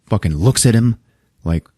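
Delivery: usual speaking voice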